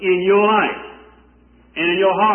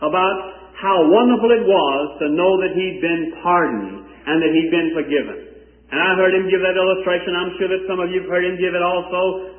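usual speaking voice

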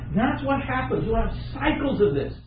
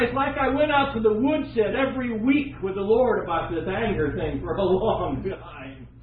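ordinary delivery

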